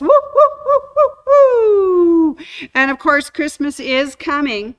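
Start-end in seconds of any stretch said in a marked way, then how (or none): 0.0-2.4 very high, loud whooping; excited woo-hoo cheers. Real, not acted. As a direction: whoop it up like a party.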